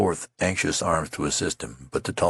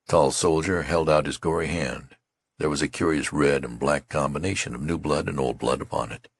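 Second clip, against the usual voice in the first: ordinary delivery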